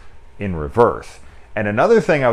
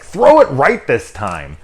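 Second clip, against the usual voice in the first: louder, slow sarcastic jab; snarky kid; 6 semitones higher, 9 dB louder, slower than usual. Retold or imitated. Kid mocking the man's failed throw, slow snark. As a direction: needle him, drawn out. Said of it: "the kid's like".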